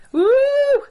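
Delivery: loud, high-pitched woo; long celebratory "woo"; excited whoop. Real, not acted. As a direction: let out a sudden high whoop. Preacher whoops before good news.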